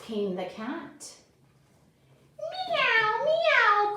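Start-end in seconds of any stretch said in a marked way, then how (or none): 2.4-4.0 much higher, mewing meow, meow; high kitty meow; cat meow. A narrator's reading. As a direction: cry like a little cat. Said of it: "cried the cat".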